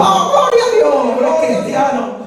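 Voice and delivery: high, laughing joyful outburst; mock joyful shout of praise; joyful cry. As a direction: cry out in glad surprise. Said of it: "empieza a dar gritos".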